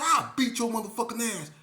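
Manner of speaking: loud high threat; furious threat; angry threat. Retold or imitated. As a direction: threaten him hard. Fight threat.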